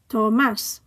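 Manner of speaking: Tomás with MÁS stressed; stress on accented syllable. Shown as a demonstration. Teacher modelling written accents, Tomás stressed at the end. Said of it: "stress the syllable that the accent is on".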